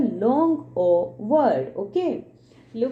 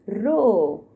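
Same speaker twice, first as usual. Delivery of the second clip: normal talking voice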